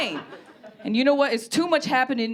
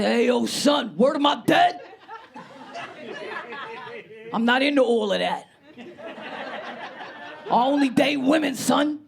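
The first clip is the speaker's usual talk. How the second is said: hammed-up New York street talk; New York accent. Turning down the anime fan, in a put-on New York accent. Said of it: "I put on my best New York accent".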